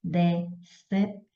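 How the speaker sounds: "decepcionado" broken into de-cep-ci-o-na-do, long pauses between; syllable by syllable. Shown as a demonstration. Teacher splitting a word for students, "decepcionado" said in separate syllables. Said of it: "se eu quero separar decepcionado".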